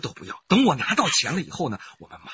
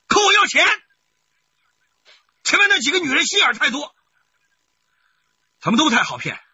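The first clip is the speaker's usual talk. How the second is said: much higher, louder boast; brazen declaration; 12 semitones higher, 5 dB louder than usual. Acted. Husband brazenly admits he wants money, loud and shameless. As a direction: shameless bragging.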